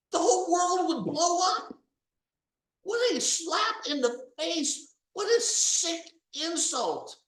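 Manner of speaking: high, loud indignant outburst; disgusted loud protest; outraged. Real, not acted. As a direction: let the outrage pour out loud.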